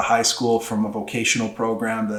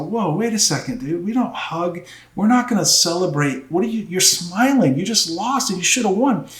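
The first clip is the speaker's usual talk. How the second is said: stern scolding; 8 semitones higher than usual. Retold or imitated. Coach scolding wrestler after loss.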